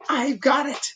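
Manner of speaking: high, bright eager thinking voice; a sudden idea, voiced high; high eureka voice for Bob; Bob's excited thought. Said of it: "He was thinking".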